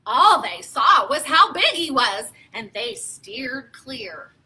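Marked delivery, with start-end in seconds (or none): 2.5-4.3 soft, slow whisper; whispered aside. A narrator's reading. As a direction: whisper it to the kids. Story read to children.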